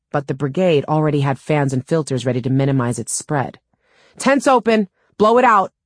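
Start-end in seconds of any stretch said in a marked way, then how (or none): 4.2-5.7 high, loud urgent yell; raised, pitched-up shout across a distance. A narrator's reading. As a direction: shout the go signal to the team. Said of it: "Aaron yelled".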